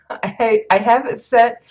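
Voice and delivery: flustered laugh while setting something up. Real, not acted. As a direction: laugh it out as you answer. Laughing at a chat reminder.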